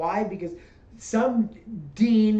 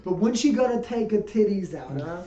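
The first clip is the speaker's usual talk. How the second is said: Brooklyn heckler voice; Brooklyn accent. Retold or imitated. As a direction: tough New York wise guy. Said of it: "I'm talking like I'm from Brooklyn".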